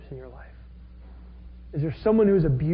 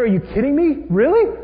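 loud, much higher disbelief; incredulous anger; 7 semitones higher, 6 dB louder than usual. Retold or imitated. Abner scoffing at Ishbosheth, loud and incredulous. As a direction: scoff at the very idea, loudly. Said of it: "He's like".

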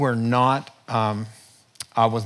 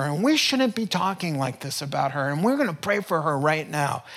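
indignant rebuke; 7 semitones higher than usual. Retold or imitated. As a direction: slam the brakes on the gossiping ladies. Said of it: "I felt my blood pressure going up".

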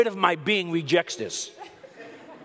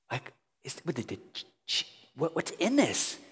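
baffled muttering; 6 dB quieter than usual. Retold or imitated. Inspecting the strange ravioli.

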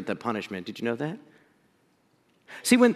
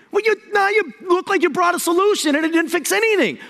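much higher, an exasperated accusing grumble; mock frustrated; 11 semitones higher than usual. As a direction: gripe at Moses like a fed-up crowd. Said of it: "even more frustrated".

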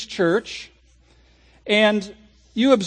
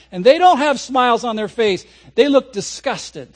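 normal talking voice

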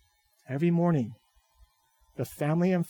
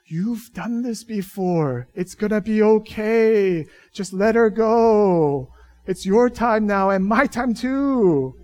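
inner pep talk; 6 semitones higher, 7 dB louder, slower than usual. Retold or imitated. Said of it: "I'm thinking".

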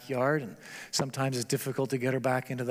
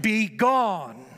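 raised, forceful command to a demon; preacher voicing Jesus rebuking the demon, loud; commanding cry; 6 semitones higher, 5 dB louder than usual. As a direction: cast it out with authority, high and loud. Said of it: "simply cries out".

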